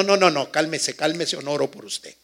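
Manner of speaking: ordinary talk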